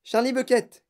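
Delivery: high, ringing call; high-pitched shout. A narrator's reading. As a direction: call the boy by name excitedly. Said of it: "cria monsieur Wonka".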